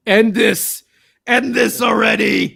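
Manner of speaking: loud, high imitation of an exasperated co-host; hammed-up pleading yell; mock shout. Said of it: "Samu was just like".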